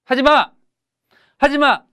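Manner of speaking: high, loud practice shouts; confident shout. Shown as a demonstration. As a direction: rehearse standing up to a bully, forcefully. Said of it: "이렇게 좀 소리 지르고".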